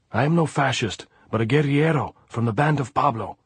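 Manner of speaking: shouted self-justification; shouting. A narrator's reading. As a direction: call out your defence to armed men. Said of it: "Andres shouted".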